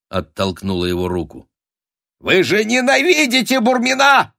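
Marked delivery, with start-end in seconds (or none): 2.2-4.4 very high, loud squealed cry; shrill, urgent protest; desperate shout. A narrator's reading. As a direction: yell it after someone leaving. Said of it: "крикнул Норов".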